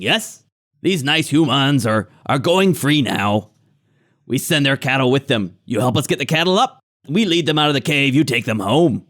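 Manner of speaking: halting, broken-speech goblin welcome; grinning hobgoblin. Acted. Hobgoblin greeting intruders with a big grin, falsely friendly. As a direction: wheedle like an overfriendly hobgoblin.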